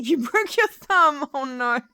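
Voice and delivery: slow, loud, laughing exclamation. Real, not acted. Streamer teases a viewer about the thumb, laughing. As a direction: laugh it out, teasing.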